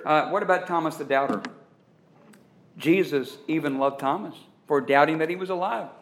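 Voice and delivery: ordinary talk, nothing marked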